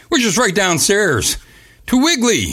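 high toothless announcer call; gummy, lisping hype voice. Pretend. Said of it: "My teeth are knocked out".

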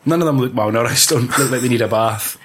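ordinary talk

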